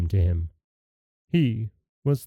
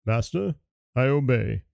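lightly voiced obedient genie; bow to your master as the genie. Genie obeys Aladdin's command.

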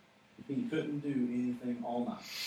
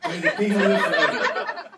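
very loud, high mock holler; hammed-up yell; 5 semitones higher, 14 dB louder than usual. Preacher as Daniel hailing the king who trapped him, hammed-up yell. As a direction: bellow it as an over-the-top salute. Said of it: "He can yell back".

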